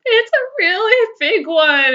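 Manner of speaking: put-on high, loud upset child's voice; crying little boy. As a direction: whimper it like a distraught five-year-old.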